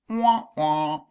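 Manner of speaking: mock losing-game "wah, wah"; sad trombone noise. Teacher marks a no answer, mock sad trombone. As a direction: imitate a deflating trombone, two drawn-out notes. Mimics losing buzzer after No.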